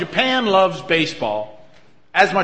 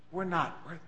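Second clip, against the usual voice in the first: much softer, almost under the breath; hushed confession; 17 dB quieter, faster than usual. Real, not acted. Pastor humbly confessing to the congregation, hushed. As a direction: let it drop to a near-hush.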